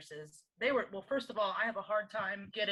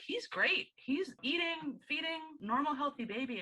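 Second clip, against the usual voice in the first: much higher, bright and eager; excited reply; 8 semitones higher than usual. Retold or imitated. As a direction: gush about the healthy baby, pitched up. Proud mother answers nurse.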